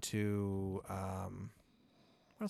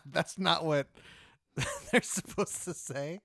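slow, high, broken up by laughter; laughing protest; 6 semitones higher, slower than usual. Real, not acted. Player objecting to being called sire, laughing. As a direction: object while giggling.

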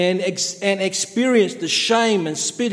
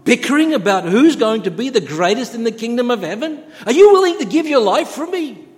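higher, louder, urgent rebuking questions; Jesus challenging disciples; 6 semitones higher, 4 dB louder than usual. Retold or imitated. Jesus confronting bickering followers, raised challenging voice. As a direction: press the questions, pitch raised, building louder. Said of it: "Jesus said".

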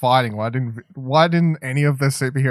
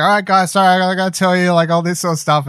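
put-on louder, fast, higher chirpy teen babble; mock Spider-Man chatter; 7 semitones higher, 6 dB louder than usual. Spider-Man apologising to Falcon and Bucky, fast eager chatter. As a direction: rattle it off like an eager kid. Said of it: "he's like".

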